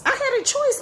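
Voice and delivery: I had a choice, with choice leaping way up high. Shown as a demonstration. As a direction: repeat it, lifting choice high.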